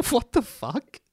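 plain reading voice

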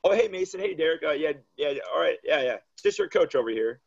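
fake-friendly sarcasm to snub the boys; sarcastic greeting. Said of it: "then I'm a smart ass".

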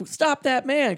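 high-pitched retold call to arms; mock urgent command. Remy sending rats after the inspector, mock urgent command. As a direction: call it out as an order, hammed up.

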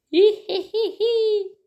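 high drawn-out giggle. Real, not acted. Giggle after pushing someone.

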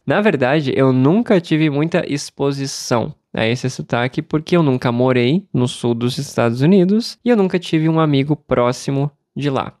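ordinary talk, nothing marked

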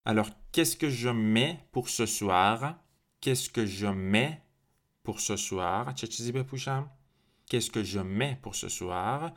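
slow questioning rise; slow asking example; model question. Shown as a demonstration. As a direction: ask it slowly for learners. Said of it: "teacher models a question slowly".

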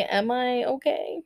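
ordinary talk, nothing marked

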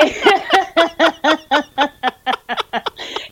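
long high peals of real laughter; helpless laughter. Real, not acted. Woman admitting she fought a boy at school, real giggling fit. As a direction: crack up at your own confession. Cracks up at her own story.

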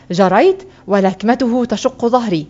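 ordinary delivery, nothing marked

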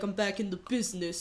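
low, soft put-on character voice; made-up Ruben voice. Imitating Ruben snapping back into action, low and soft. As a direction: drop low and quiet as an invented Ruben. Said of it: "just how I've decided he sounds".

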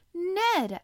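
shouting a name in surprise; cry of recognition. Acted. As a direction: call out the name in sudden recognition. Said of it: "I cried".

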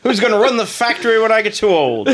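high, loud put-on wail; hammed-up fretting father; old-timey parent. Voicing old-timey factory owner parent.

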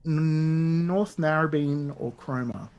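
normal talking voice

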